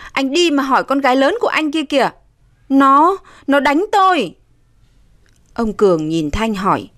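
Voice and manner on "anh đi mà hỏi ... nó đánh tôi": higher, aggrieved outcry; crying out. A narrator's reading. Wife accusing stepdaughter to husband, crying out. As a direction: complain bitterly and urgently. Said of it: "Bà Sang kêu lên".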